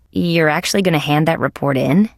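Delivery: normal talking voice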